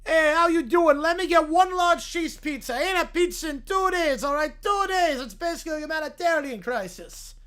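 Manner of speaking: pushy New York caller; thick New York accent. Acted. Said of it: "I have a thick New York accent".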